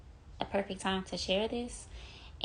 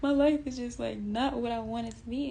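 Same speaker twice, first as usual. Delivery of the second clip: higher, louder mock whine that eases off by the end; mock whining to God; 6 semitones higher, 4 dB louder than usual. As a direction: whine it like you're complaining to God. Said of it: "crying to God".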